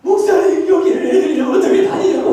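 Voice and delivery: high-pitched tearful complaint, hammed up; mock crying. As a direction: imitate a visitor wailing to the pastor. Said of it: "막 이러면서 막 우는 거예요". (우는 흉내로 하소연)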